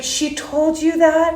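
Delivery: high, drawn-out astonished question; retold surprise. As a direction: imitate someone pleasantly taken aback. Voicing V G's surprised question.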